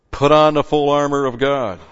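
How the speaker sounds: loud, full-force preaching read; preaching scripture. Acted. Preacher reading scripture to his congregation, full force. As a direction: proclaim the verse to the congregation.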